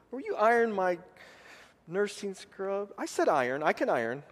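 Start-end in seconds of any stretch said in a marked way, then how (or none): 0.1-2.9 lightly voiced wife asking sweetly; wife's sweet request. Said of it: "Tracy's voice".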